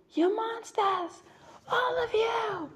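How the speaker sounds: high, strained cry of accusation; quoting the character's outburst. Retold or imitated. Vlogger quoting Sejanus's outburst, high strained cry. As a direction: yell it high at the Capitol crowd. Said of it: "the scene of where he goes".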